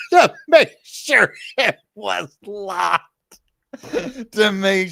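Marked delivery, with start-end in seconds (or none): 0.1-3.0 slow, high words squeezed out through laughter; laughing. Real, not acted. Laughing while telling how her scared sister crawled to lock the door. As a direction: still laughing as she gets it out.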